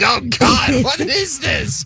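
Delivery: high, startled cry, slowing to a near shout; shocked. Retold or imitated. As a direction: recoil in horror, pitch way up. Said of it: "I'm just like".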